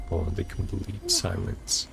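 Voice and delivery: ordinary delivery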